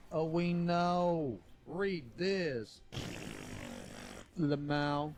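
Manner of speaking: silly drawn-out cartoon emote voice; imagined emote voice. Shown as a demonstration. Streamer showing viewers how he reads emotes, silly character voice. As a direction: stretch it out as a talking emote. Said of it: "I read it in the voice of what I think the emote has".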